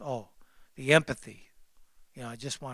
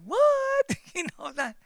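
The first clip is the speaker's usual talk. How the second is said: high, startled yelp; shocked parent's cry; stunned what; 5 dB louder than usual. Retold or imitated. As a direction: squeak it in disbelief. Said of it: "And we're like".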